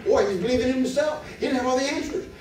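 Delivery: high, loud, driving sermon delivery; fervent preaching. Acted. Preacher pressing the congregation about faith, fervent. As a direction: preach it hard, rising to a peak. Preacher builds to full force.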